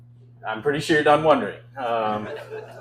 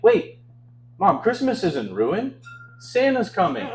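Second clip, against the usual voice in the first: put-on higher, slower eager kid voice; hopeful child; 7 semitones higher, slower than usual. Comic voicing his child self reassuring mom, eager and higher. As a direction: play the hopeful nine-year-old.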